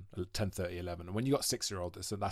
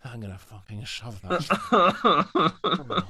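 laughing exasperation; 5 semitones higher, 6 dB louder, much slower than usual. Retold or imitated. Dad's exasperated reply to son.

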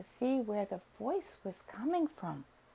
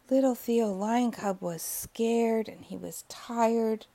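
ordinary delivery, nothing marked

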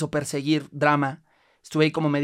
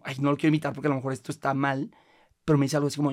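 normal talking voice

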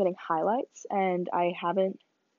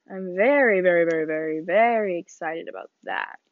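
loud, building excitement; emphatic very very very; excited; 7 dB louder than usual. Real, not acted. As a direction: gush about the trip. Stretched very very excitement.

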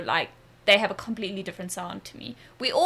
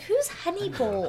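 1 baffled question; 9 semitones higher than usual. Retold or imitated. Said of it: "And I was like".

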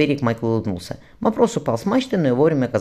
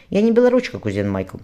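ordinary delivery

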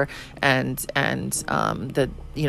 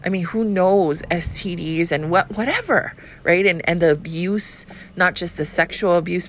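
ordinary talk

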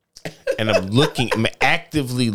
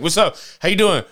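pushy salesman; 8 semitones higher, 3 dB louder than usual. Retold or imitated. Pushy Foot Locker staff imitated.

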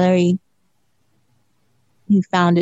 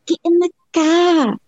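put-on high, slow, botched Boston accent; drawn-out mock Boston, pitched up; Boston accent attempt; 8 semitones higher, slower than usual. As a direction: have a clumsy go at Boston. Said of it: "I can't do it".

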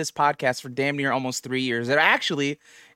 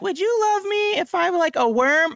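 mock clingy girlfriend. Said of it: "I hate the questions like".

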